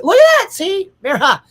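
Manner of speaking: very high, loud burst of delight; excited outburst. Real, not acted. Streamer spotting a friend's matching travel plans, excited. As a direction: shoot the pitch right up, thrilled.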